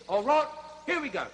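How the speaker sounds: normal talking voice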